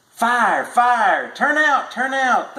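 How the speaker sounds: high ringing alarm cry; fire bell call. A narrator's reading. Fire bell sounding the alarm, high ringing call. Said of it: "the fire bells jangled".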